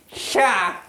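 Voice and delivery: slow puzzled simpleton. Retold or imitated. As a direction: play the simpleton saying sha. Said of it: "You make me sound like an absolute simpleton".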